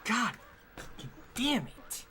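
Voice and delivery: real rage at the game; frustrated yell. Real, not acted. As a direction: let the anger burst out slowly. Crashes in racing game.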